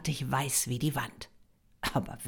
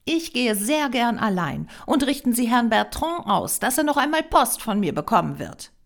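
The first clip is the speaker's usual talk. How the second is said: high, loud and indignant; huffy parting threat; offended refusal; 7 semitones higher, 6 dB louder than usual. A narrator's reading. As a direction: turn him down sharply, voice raised. Offended applicant refuses escort, threatens letter.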